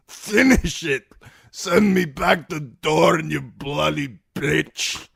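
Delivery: loud, high, slow desperate shout; dying plea. Acted. Wounded Timion begging Brienne to finish him, shouting. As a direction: beg for the killing blow.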